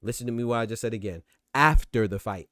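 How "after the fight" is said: AFTER punched much louder; stress on AFTER. Shown as a demonstration. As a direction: hit the word After hard, then drop back. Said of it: "Emphasis, once again".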